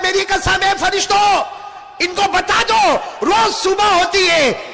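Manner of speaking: high, loud proclaiming voice; voice of God. Acted. Preacher voicing God's oath to angels, high and loud. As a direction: declare it like a divine oath. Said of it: "अल्लाह ताला कहता है".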